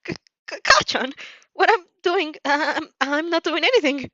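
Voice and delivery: high, stammering, panicky excuse; a caught kid stuttering out of trouble; nervous stuttering. Acted. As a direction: trip over the name then rush the denial. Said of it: "not without some stuttering".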